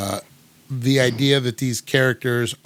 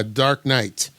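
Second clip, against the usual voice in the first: ordinary delivery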